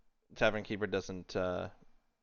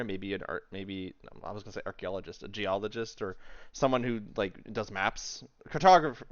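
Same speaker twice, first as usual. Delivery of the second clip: ordinary talk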